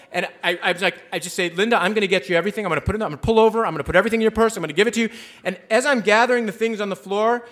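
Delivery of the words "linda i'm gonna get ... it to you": rapid-fire reassurance; quick, anxious appeasing; hurried placating. Retold or imitated. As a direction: rattle off promises to calm her down. Placating her in a hurry.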